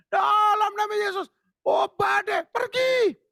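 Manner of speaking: high shouted command at the storm; mock rebuke shout. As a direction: bark the storm away in Jesus' name. Imitating Christians rebuking the storm.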